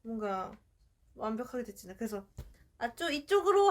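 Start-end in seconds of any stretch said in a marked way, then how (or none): 2.8-3.7 loud high call to the dog; yelling across the room. Retold or imitated. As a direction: call the puppy over loudly. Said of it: "하면 이쪽으로 와요".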